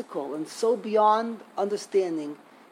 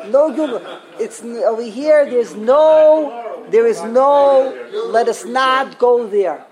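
much louder, forceful, shutting the topic down; firm raised voice, refusing to go there; emphatic loud refusal; 3 semitones higher, 11 dB louder, slower than usual. Real, not acted. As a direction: slam the door on the subject. Heated refusal to discuss Gilgul.

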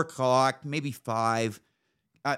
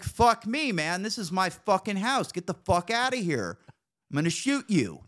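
angry yell; 9 semitones higher than usual. Retold or imitated. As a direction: scream him off the property. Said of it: "I was like".

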